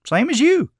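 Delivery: high, mildly baffled answer; puzzled reply. A narrator's reading. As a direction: state the obvious, confused. Puzzled boy replies.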